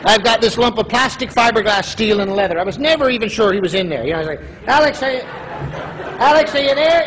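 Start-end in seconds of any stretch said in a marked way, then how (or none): 4.7-7.1 high-pitched searching call; mock puzzled calling for a child. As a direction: call to someone buried out of sight. Calling into son's hockey gear.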